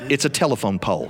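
plain reading voice

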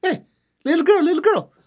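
put-on high, teasing call to the girl; cocky chief calling out. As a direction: tease her from the hilltop. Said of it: "Ahua look at this girl, say".

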